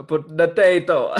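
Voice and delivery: drawn-out, loud, bad Irish accent; slow, laughing stab at an Irish accent; attempted Irish accent. Contestant answering a potato quiz question, bad Irish accent. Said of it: "What accent is that again?".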